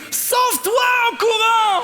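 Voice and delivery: Sauve-toi en courant yelled very high, loud and slow; punchline shout. Retold or imitated. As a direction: blast out the advice as a shout. Pastor's punchline advice to girl.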